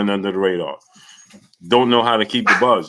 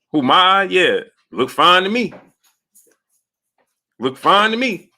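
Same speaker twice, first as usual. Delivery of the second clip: imitating Errol; 8 semitones higher than usual. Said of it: "the way you said Errol responded".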